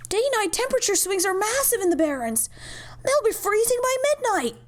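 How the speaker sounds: high, pleading alarm; rising alarmed pitch; alarmed protest. Acted. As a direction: plead urgently against the power cut. Doctor alarmed at power cut.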